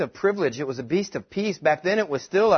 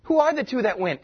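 ordinary delivery

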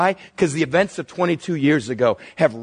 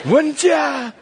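laughing aside; 9 semitones higher than usual. Real, not acted.

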